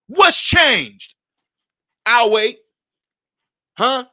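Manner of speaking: ordinary talk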